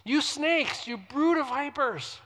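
loud, high preaching of the woes; forceful scripture reading. Acted. Pastor reads Jesus rebuking Pharisees, loud preaching.

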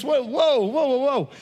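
startled exclamation at a harsh ending; stunned whoa. Real, not acted. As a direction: react like you've been punched in the stomach.

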